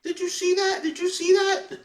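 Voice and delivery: very high, thrilled squeal; elated kid. Retold or imitated. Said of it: "he was elated".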